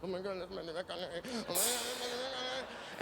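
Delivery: starstruck panic rising into a squeal; mock freak-out. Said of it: "I start freaking out".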